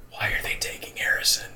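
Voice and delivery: barely audible whisper; hushed, voiceless interjection; whispered aside. Real, not acted. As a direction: whisper it under your breath. Whispered aside during episode recap.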